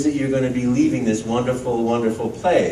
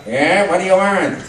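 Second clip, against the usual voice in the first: slightly higher, drawn brother voice; Luigi voice; 5 semitones higher than usual. Retold or imitated. Luigi answering Mario from the kitchen, a light Luigi voice. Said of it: "And I go".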